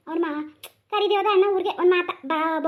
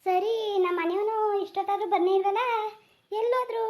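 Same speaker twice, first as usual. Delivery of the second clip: worried wife; 7 semitones higher than usual. Acted. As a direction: fret as the waiting wife. Wife wondering, high soft.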